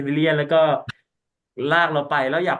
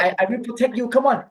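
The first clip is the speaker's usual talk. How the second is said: acted protector; 10 semitones higher, 4 dB louder, slower than usual. Acting out heroic protective line.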